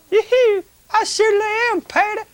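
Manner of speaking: high-pitched Southern Belle puppet voice; Southern Belle in falsetto; Southern Belle accent. Pretend. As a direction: chirp it as a perky Southern Belle. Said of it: "get a Southern Belle accent".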